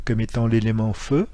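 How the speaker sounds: ordinary delivery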